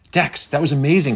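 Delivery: high, loud amazement; excited cry. A narrator's reading. Penny praises Dex after the drone leaves, excited. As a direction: gush with delight. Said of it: "cried Penny".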